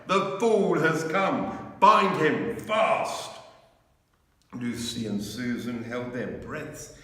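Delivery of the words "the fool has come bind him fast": high, loud and slow witch's command; wild triumphant witch shout; fierce witch. A narrator's reading. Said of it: "gave a wild, fierce laugh".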